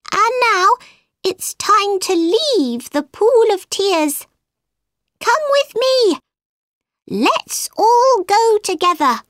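high-pitched mouse leading the way; squeaky mouse. A narrator's reading. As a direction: speak high and squeaky, urging them along. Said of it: "His voice was high and squeaky".

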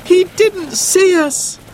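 put-on slow, very high girl's lament; dismayed cry. A narrator's reading. Sarah realises the pilot missed them, crying out dismayed. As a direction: cry it slowly in high-pitched disappointment. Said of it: "cried Sarah".

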